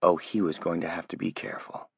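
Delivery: ordinary delivery, nothing marked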